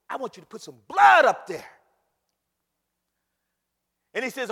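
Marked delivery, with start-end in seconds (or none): 0.1-1.8 loud burst on the blood; emphatic divine command. Acted. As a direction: build to a booming order. Preacher voices God's command.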